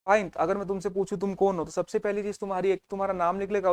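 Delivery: usual speaking voice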